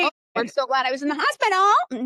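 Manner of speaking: high, loud gushing mock relief; mock grateful patient. Mocking a patient who thanks the hospital, high gushing voice.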